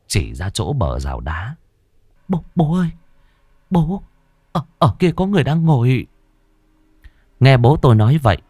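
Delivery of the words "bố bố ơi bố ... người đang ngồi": high-pitched, halting, scared stutter; frightened stammering. A narrator's reading. Child pointing out a figure to father, scared stammer. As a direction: stammer in fear, repeating the first words. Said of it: "Tôi run rẩy miệng lắp bắp".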